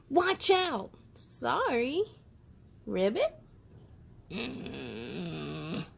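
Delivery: lively picture-book read-aloud with voiced characters; reading to children. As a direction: bring each rainy-day page to life for little listeners.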